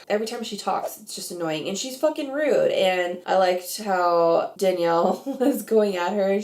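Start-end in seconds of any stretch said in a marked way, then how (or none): none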